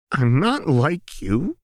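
high, slow, breaking on the words; fighting back tears; voice cracking. A narrator's reading. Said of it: "Jinx's voice cracked".

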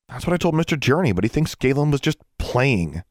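high, scornful complaint; snorted. A narrator's reading. Girl telling friend that Journey disbelieved her, snorted. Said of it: "Alexandra snorted".

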